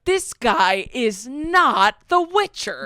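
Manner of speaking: hammed-up indignation; mock outraged fans. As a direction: imitate indignant fans, drawing out each word. Mimics outraged fans online.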